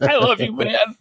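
put-on high-pitched gushing drunk; hammed-up tipsy declaration; mock drunk affection. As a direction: gush like a sloppy drunk buddy, as a joke. Playing a sentimental drunk, joking.

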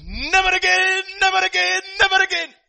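high anguished wail, loud and breaking; crying out never again. A narrator's reading. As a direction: cry it out as if the memory tears at him. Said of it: "that guy begins to cry and he says".

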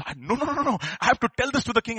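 high, urgent insistence; can't wait. Acted. Messenger desperate to tell the king, high impatient insistence. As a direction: burst out with it, impatient to go. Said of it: "that fellow can't wait".

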